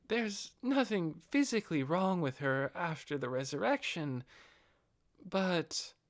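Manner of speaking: a lightly voiced noble lady trailing off; devoted female retainer. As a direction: report it, then falter. Narrator voices Albedo.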